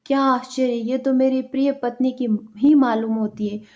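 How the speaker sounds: ordinary delivery, nothing marked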